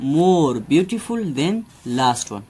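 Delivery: very slow, word by word example sentence; slow dictation. Shown as a demonstration. Teacher dictating an example to students, very slow. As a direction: spell it out slowly for the class. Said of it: "तो उसके साथ हम more लगाएंगे".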